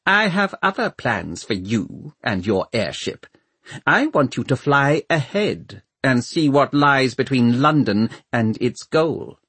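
cold Lord Mayor giving orders; cold, thin voice. A narrator's reading. Lord Mayor sending Valentine ahead by airship, cold and thin. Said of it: "cold, thin voice of the Lord Mayor".

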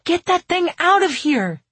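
high, loud indignant order; crying out. A narrator's reading. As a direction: shout it as an outraged demand. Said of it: "I cried when Joe told me".

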